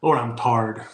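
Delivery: Southern "tarred", lightly voiced; a weary Southerner saying "Lord, I'm tarred"; Southern accent. Shown as a demonstration. Explaining Southern speech to viewers, in a Southern accent. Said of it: "They say".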